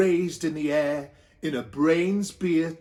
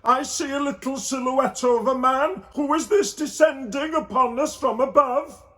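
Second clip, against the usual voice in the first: higher, coaxing voice of Joseph; 7 semitones higher, 3 dB louder than usual. A narrator's reading. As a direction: urge her on gently. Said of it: "said Joseph".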